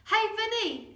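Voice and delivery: high, loud taunting yell. Acted. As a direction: shout it back down the track. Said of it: "he yelled".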